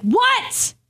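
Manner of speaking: a sharp, high snapped "What?"; mock snap. Tired mom snapping at her son, sharp and high. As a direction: bite back at the kid who keeps calling. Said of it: "I, I can snap".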